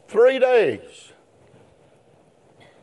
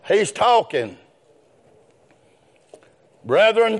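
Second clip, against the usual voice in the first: ordinary delivery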